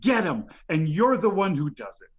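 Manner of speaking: ordinary delivery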